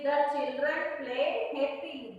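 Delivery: very slow, word by word; careful classroom read-aloud. Shown as a demonstration. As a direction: read the sentence slowly for the children to follow. Reads the exercise sentence aloud.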